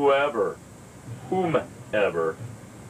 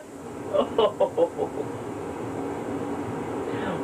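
airy giggle; 10 semitones higher, 5 dB quieter than usual. Pretend. Pretend chat laughter.

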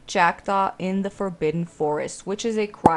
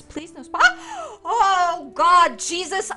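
scared laughing cry; 9 semitones higher, slower than usual. Real, not acted.

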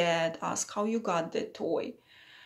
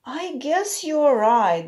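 smiling reply; 4 semitones higher, 7 dB louder, slower than usual. A narrator's reading. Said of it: "the owner replied and smiled".

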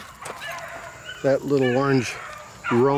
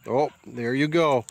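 ordinary talk, nothing marked

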